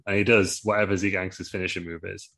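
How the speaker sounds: ordinary delivery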